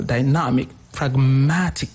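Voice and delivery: "dynamic, pragmatic" stressed on NAM and MAT; second-syllable stress. Shown as a demonstration. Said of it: "The second syllable".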